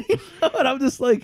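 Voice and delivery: high, laughing words. Real, not acted. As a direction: talk through laughter after your own silly impression. Cracking up after own impression.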